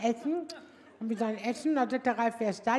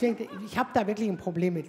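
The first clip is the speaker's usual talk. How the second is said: ordinary delivery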